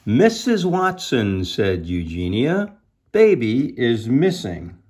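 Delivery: slow, high-swooping storybook alarm; dramatic story reading. A narrator's reading. Eugenia telling Missus Watson at the door, acted alarm. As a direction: stretch out the missing-baby news dramatically.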